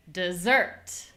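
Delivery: "Dessert" stressed on SERT; light "De", strong "ssert"; second-syllable stress. Shown as a demonstration. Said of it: "only changing the stress".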